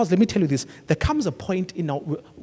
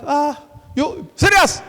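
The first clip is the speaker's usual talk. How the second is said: mock disbelief; 8 semitones higher, 4 dB louder, slower than usual. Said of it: "You're like".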